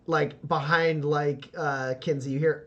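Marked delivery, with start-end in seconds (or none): none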